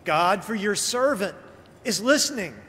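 ordinary delivery

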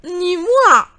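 high, loud turkey squawk; gobbling turkey. Acted. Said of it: "glouglouta le dindon".

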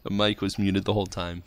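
loud announcer call to the chat; announcer shout. Acted. Streamer telling viewers the mic news, loud announcer shout. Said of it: "ladies and gentlemen".

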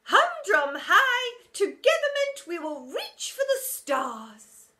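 high, loud, slow soaring rally; inspirational teacher. A narrator's reading. Teacher rallying the school, slow and soaring. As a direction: reach for the stars grandly.

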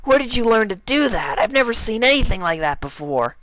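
very high, loud filly voice; gushing excitement. Acted. Rainbow Dash gushing at Applejack, very high and loud. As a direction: gush like a starstruck fan.

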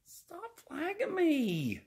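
high, slow whining plea; slow, high put-upon whine; whiny plea. Acted. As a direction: stretch it out high and pleading.

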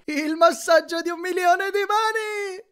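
high, loud, blissful cry of delight; excited exclamation. A narrator's reading. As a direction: gush with wild joy at the massage. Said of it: "esclamò Grover".

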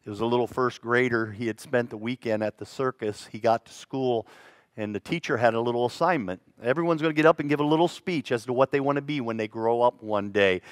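normal talking voice

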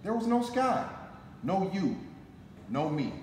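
ordinary delivery

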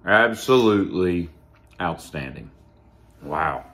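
speaking between bites; chewing while talking. Real, not acted. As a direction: mouth partly full of food. Seen 0: Bites chocolate treat around 1.6s, chews while speaking, bitten piece in hand.

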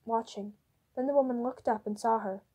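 ordinary delivery

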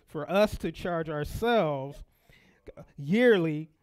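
slow build to a loud "yearly"; emphasized. Shown as a demonstration. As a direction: drag "for us to charge ourselves", then punch "yearly". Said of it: "I'm emphasizing that".

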